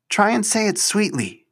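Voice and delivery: dry sarcastic jab at her suitor. Acted. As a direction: say it with a sarcastic sneer. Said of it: "she said sarcastically".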